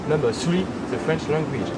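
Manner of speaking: ordinary talk, nothing marked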